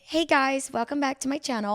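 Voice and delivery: put-on upbeat YouTuber Valley girl intro; chirpy vlog greeting in a Valley accent. Teen practising a Valley accent YouTube intro in the mirror. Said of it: "I would say it with my Valley accent".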